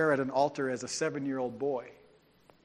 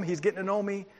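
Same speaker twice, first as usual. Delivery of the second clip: normal talking voice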